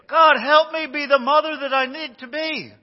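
put-on high, loud little boy voice; child praying. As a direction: play the little boy crying out to God. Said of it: "he's saying".

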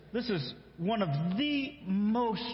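ordinary delivery

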